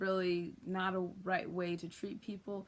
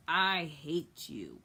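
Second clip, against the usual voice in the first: slow, low, deliberate angry declaration; angry little fish turning on his dad; drawn-out, heavy defiance; Nemo; 4 semitones lower, 4 dB louder, much slower than usual. Acted. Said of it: "he gets angry at his dad".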